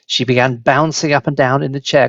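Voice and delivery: ordinary delivery, nothing marked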